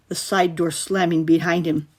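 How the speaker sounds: plain reading voice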